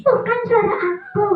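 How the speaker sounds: high puppet protest; puppet character. Acted. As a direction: insist as a high-voiced puppet. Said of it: "suara [name]".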